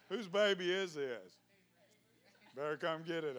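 ordinary delivery

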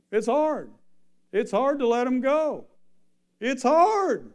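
impassioned preaching. Real, not acted. Preacher climaxes on letting children go.

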